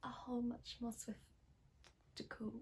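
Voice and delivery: slow, soft playful prediction; mock solemn hush. Interview answer about Christmas, hushed and playful.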